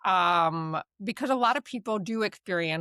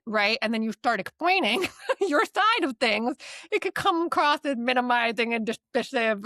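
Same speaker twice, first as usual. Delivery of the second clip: usual speaking voice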